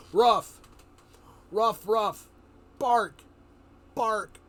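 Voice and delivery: a man faking a bark badly, saying ruff and bark; bad dog bark. Telling viewers how the dog-man faked barking, loud mock bark. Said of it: "he couldn't really do a bark all that well, so he'd go".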